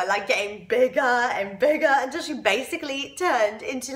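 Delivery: usual speaking voice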